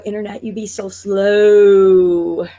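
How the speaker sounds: dragged-out, stretched slow words; slow motion voice. Acted. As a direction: drag every word out in frustration. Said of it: "how I talk in slow motion voice".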